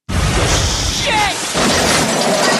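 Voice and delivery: sudden loud yelp of surprise; startled yell. Acted. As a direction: yell out as things fall. Crash in dark storage unit.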